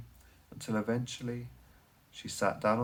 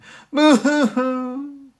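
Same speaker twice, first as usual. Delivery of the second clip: high, loud delighted cackle; gleeful cackle; 13 dB louder than usual. Acted. The Tear Thief cackling as she hears a crying child. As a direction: cackle with glee at the sound of crying.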